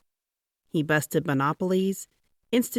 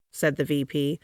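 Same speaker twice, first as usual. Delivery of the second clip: ordinary delivery, nothing marked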